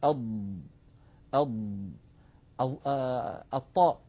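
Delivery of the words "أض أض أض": أض held between tongue and palate; dad with sealed tongue. Shown as a demonstration. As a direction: demonstrate the sealed أض. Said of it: "كذلك الضاد". (أض بإطباق)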